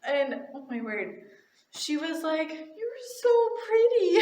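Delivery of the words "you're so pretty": put-on very high, singsong child voice; pitched-up, lilting little-kid praise; little girl. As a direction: imitate a child's gushing compliment. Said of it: "then the girl turned around".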